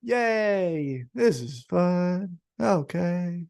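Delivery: slow, flat mock cheer, voice sinking; unenthused crowd cheer. Mimicking a bored crowd, a slow half-hearted cheer. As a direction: cheer like you don't mean it, dragging each word. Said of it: "the crowd was going".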